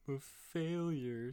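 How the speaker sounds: high, drawn-out cartoon wail of self-pity; Bart Simpson. Quoting a sad cartoon edit to a friend, slow high mock despair. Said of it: "the episode where he's like".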